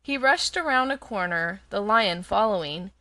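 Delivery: ordinary delivery, nothing marked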